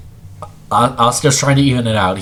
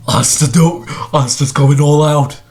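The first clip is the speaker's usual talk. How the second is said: excited play-by-play; 4 semitones higher than usual. Real, not acted. Reacting to sudden all-out attack.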